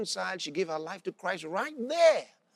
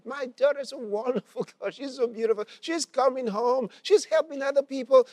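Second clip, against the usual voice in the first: high, gushing retold joy; happy excitement; 6 semitones higher, 4 dB louder than usual. Mother telling pastor about her daughter, happily excited. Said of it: "saying all these things so happily".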